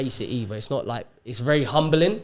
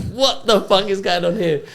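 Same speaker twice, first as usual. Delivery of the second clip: freaking out; 7 semitones higher, 7 dB louder than usual. Retold or imitated. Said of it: "I'm, like, freaking out".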